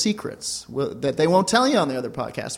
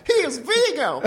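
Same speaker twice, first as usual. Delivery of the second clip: much higher grandma squeak; doting grandparent; 11 semitones higher than usual. Acted. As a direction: push the granny voice way up. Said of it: "That was pretty good, right?".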